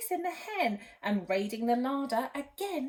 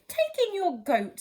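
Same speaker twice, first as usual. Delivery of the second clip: put-on 1 brisk wise old man; 6 semitones higher, 6 dB louder than usual.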